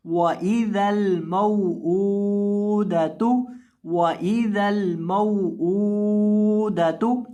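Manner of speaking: मौऊदतु with short meem and the hamza ऊ held long; slow recitation, stretch the ऊ not the meem. Shown as a demonstration. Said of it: "हमदा को लंबा करके पढ़ेंगे".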